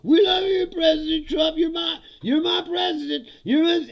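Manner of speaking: high, loud gushing praise; over-the-top adoring supporter voice; mock adoring fan. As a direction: ham it up like a fawning bot. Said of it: "They're like".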